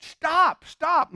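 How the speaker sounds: high-pitched repeated order; urgent protest. Acted. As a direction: cut him off as the alarmed crowd. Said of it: "They said".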